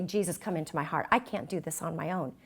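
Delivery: hushed prayer to Jesus; quiet prayer. Retold or imitated. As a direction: pray it softly and humbly. Humble prayer quoted in sermon.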